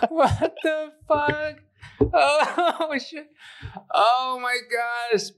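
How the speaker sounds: high, slow laughing exclamations; laughing disbelief. Real, not acted. Reacting to his co-host's joke in laughing disbelief. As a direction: gasp it out through laughter.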